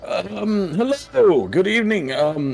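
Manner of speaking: put-on high, slow nervous old shopkeeper; flustered old man stammering to the gnome.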